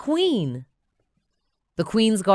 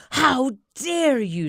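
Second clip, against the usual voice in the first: higher, slow outraged villainess; furious yell; 8 semitones higher, 5 dB louder, slower than usual. Acted. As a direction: spit out the outrage. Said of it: "she yells".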